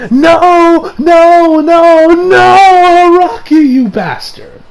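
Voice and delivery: high wailed shouts, easing off into cursing; despairing mock scream. Fan raging at the author, hammed-up screaming. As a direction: wail in protest, then curse the author. Re-enacting his despairing tweet at Araki.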